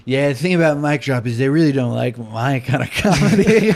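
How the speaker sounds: tipsy. Retold or imitated. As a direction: say it a bit drunk and loose. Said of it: "I was a little drunk".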